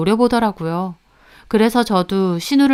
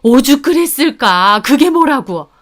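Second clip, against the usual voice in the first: affronted, raised-voice defending; blaming mother-in-law; 4 semitones higher, 6 dB louder than usual. A narrator's reading. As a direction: brush it off indignantly. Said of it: "끝까지 제 탓을 하시더라고요".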